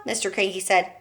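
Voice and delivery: ordinary delivery, nothing marked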